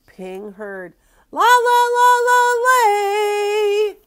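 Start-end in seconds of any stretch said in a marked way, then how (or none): 1.3-4.0 very high, very loud singsong call. A narrator's reading. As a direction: holler a long call across the river. Calling heard across the river.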